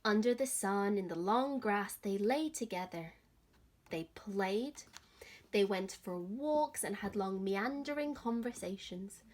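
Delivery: put-on gentle, expressive read-aloud for children; lively picture-book reading; story narration. A narrator's reading. Teacher reads story to children.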